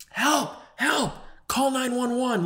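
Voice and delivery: high-pitched mock cries for help; imitated panicked yelling. Imitating bystanders yelling as someone collapses on court, high mock yell. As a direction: call out high, mimicking bystanders in alarm. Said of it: "everyone starts yelling".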